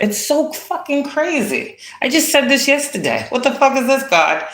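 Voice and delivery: high, disbelieving cry of frustration; incredulous outburst. Real, not acted. Reader to audience, stunned by coincidence, high disbelieving voice. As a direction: squeal it out in disbelief at the timing.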